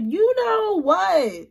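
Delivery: drawn out high and slow, sarcastic; mocking disbelief. Mocking the date's suggestive hint back to viewers, drawn out high. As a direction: stretch it out in incredulous mockery.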